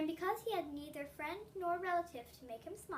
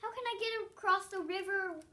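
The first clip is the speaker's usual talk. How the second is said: anxious; 6 semitones higher, 6 dB louder than usual. Acted. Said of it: "Juan asked anxiously".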